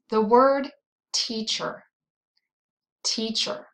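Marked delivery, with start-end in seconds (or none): 1.1-3.7 "teacher" with the first syllable stronger; stress on first syllable. Shown as a demonstration. Teacher showing learners the stronger part, first-syllable stress on "teacher". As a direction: lean on the "tea" in "teacher". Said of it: "If you said the first part".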